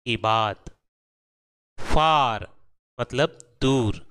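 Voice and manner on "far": far with a long "aa", louder; open ah sound. Shown as a demonstration. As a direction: say far as "faar". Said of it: "साफ आ की sound".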